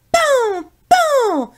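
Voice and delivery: high, loud mock gunshots; shouting bang bang. Pierre playing hunter, loud high bang bang. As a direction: yell pan pan like a toy gun. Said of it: "en criant très fort".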